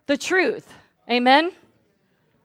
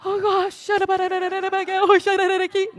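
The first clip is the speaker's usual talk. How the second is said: timid whimper; 6 semitones higher, slower than usual. Retold or imitated. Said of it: "I didn't go".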